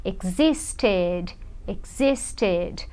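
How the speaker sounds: low, soft, drawn-out "existed"; ED as extra syllable. Shown as a demonstration. Teacher models for attentive learners, "existed" syllable by syllable. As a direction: sound out "existed" with a full "ted". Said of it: "o som que é importante".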